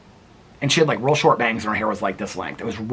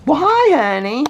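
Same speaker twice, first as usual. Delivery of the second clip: much higher and louder cooing hello; 13 semitones higher, 5 dB louder than usual. Real, not acted. Greeting the dog again.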